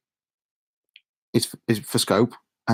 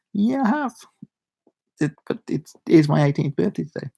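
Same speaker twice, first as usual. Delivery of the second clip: sheepish protest; 6 semitones higher than usual. Retold or imitated. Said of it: "I was like".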